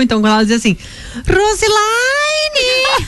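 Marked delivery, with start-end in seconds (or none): 1.3-3.1 put-on Roselaine shouted high and slow in a German accent; german accent portuguese. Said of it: "minha mãe tem sotaque alemão".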